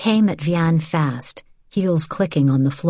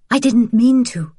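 higher, louder anxious apology; dry-throated fear; 7 semitones higher, 5 dB louder than usual. Acted. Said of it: "her throat dry".

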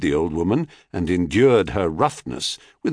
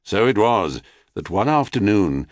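usual speaking voice

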